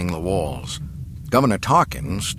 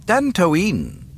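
higher, matter-of-fact official; explaining villain; 11 semitones higher, 4 dB louder than usual. A narrator's reading. Governor explaining his choice to the captive, cool and clear. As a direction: say the name clearly, starting an explanation. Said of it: "Tarkin explained".

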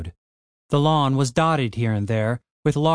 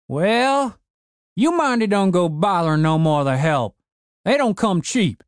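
put-on cranky old man; 6 semitones higher than usual. A narrator's reading. Old man ordering his broken zombie gardener, gruff old voice.

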